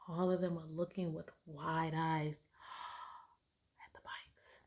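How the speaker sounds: hushed whisper with a gasp; teacher whispering to the class. Real, not acted. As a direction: whisper it in wonder.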